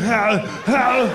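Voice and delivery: drawn-out put-on grunt; two long mock howls; imitated drunken groan. Answering police when pulled over, mock drunk groaning. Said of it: "that's exactly how you sound".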